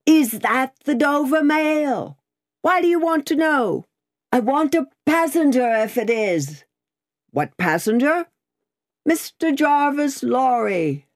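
high, held calls across a distance; calling out. A narrator's reading. Guard and rider question each other through mist, calling out. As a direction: hail someone unseen through mist.

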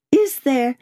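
high, light, tentative opening; affectedly casual. A narrator's reading. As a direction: start a question as if by chance. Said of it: "come at it in this chance manner".

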